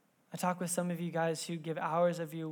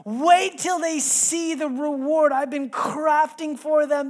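high, loud, slow, eager voice of God; a father who can't wait to give a gift; 9 semitones higher, 11 dB louder, slower than usual. Pretend. God in heaven eager to surprise his children, high and slow. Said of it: "vision of God ... just saying".

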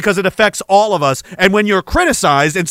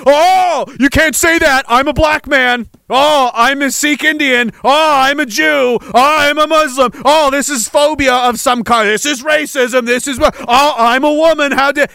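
sneering high-pitched mock protest; mock whining; 7 semitones higher than usual. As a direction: parody the offended excuse-makers. Mocking whiny identity excuses.

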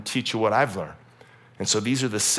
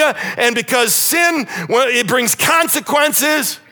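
high, loud, emphatic warning; Joshua warning; 12 semitones higher, 9 dB louder than usual. Retold or imitated. Joshua warning the people about a jealous Lord, loud. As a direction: hammer home the danger of sin. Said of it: "And he says".

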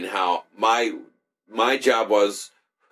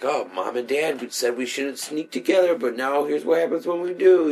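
mock breathy caption read; 5 semitones higher than usual. Reading porn caption aloud.

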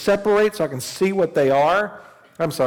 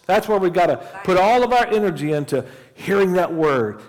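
raised, fervent preaching voice; preaching at full force; 6 semitones higher, 4 dB louder than usual. Acted. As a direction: preach it out with fire, higher and louder.

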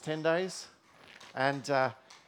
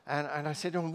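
ordinary delivery

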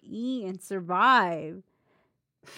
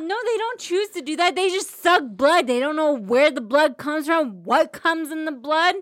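heated protest; 6 semitones higher, 5 dB louder than usual. Real, not acted. Heated defense of mosquitoes.

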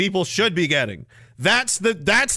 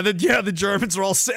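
ordinary talk

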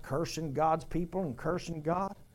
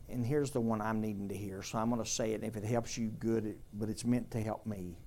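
quiet confession; 7 semitones lower, 8 dB quieter than usual. Real, not acted. Preacher turns inward, confessional.

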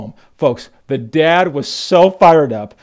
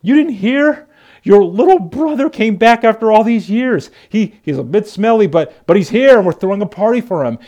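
much higher, bright gleeful telling; gleeful father; 7 semitones higher, 5 dB louder than usual. Retold or imitated. Father telling older brother, gleeful and high. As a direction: burst out the good news. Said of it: "with glee ... he's like".

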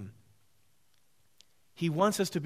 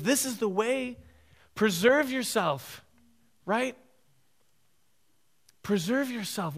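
voicing Satan; 7 semitones higher, 4 dB louder than usual. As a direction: sweetly lure him off the path. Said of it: "He wanted to put in his ways".

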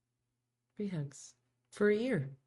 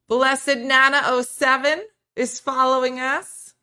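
excited shout-out; 8 semitones higher, 8 dB louder than usual. Real, not acted.

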